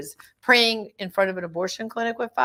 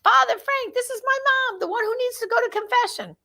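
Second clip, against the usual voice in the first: put-on little girl. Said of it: "my little daughter says to Father Frank".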